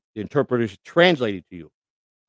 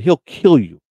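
uncle's warning; 11 dB louder, slower than usual. Retold or imitated. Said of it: "He said".

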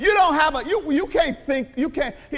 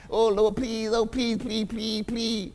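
softer, lower whiny pleading; mild mock plea, fading softer; mock begging; 5 semitones lower, 5 dB quieter, slower than usual. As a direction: imitate someone begging God. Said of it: "keep on begging".

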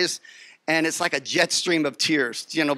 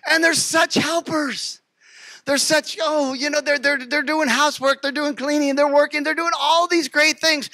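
gushing; 8 semitones higher than usual. Acted. Gushing about the helpful robots.

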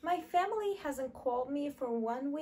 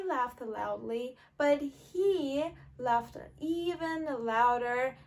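slow and loud on "even louder"; stress on even louder; 5 dB louder, slower than usual. Shown as a demonstration. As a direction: stretch "he laughed even louder" to make the contrast clear. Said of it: "adding the word even to emphasize".

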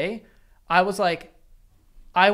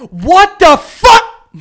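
slow, much higher, loud angry yell; a mock gamer meltdown, loud and drawn out; mock rage yell; 13 semitones higher, 19 dB louder, much slower than usual. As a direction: yell it like a sore loser. Mocking friend's rage quit.

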